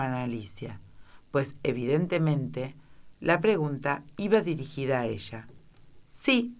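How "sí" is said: shouted yes. A narrator's reading. Alicia answering the queen, light shout. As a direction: call it out across the field. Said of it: "gritó Alicia".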